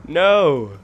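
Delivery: loud cry of no; despairing shout. Real, not acted. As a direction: yell it in defeat. Gets killed suddenly.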